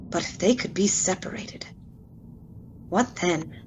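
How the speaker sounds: hushed whisper. Acted. Jekyll confides his dark idea to himself, whispering. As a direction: let the last question slip out as a secret.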